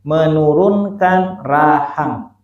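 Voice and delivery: "Menurunkan rahang" drawn out slowly; slow explanation. Shown as a demonstration. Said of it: "Apa khafdul fakki". ("Menurunkan rahang" diucapkan perlahan)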